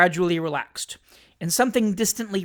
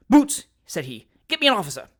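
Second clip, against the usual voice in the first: sharp order; 3 semitones higher, 6 dB louder than usual. A narrator's reading. Jingle calling the boots, loud order. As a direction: bark the order at the servant.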